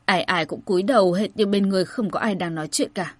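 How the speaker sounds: plain reading voice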